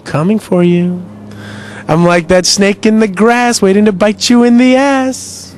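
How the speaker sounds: loud, slow trash-talk taunt. Acted. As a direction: taunt the rival menacingly. Taunting unknown opponent.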